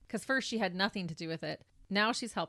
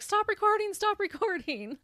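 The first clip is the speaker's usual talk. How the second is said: high-pitched, raised mock yell; imitated yell; 12 semitones higher, 5 dB louder than usual. Host imitating the judge ordering the camera person, mock yell. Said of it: "you hear the judge yell at the camera person".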